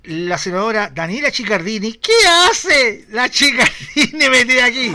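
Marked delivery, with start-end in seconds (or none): 1.9-4.9 slow, louder, laughing disbelief; amused at her butting in. Real, not acted. Se ríe de la intrusión. (riendo, incrédula y lenta)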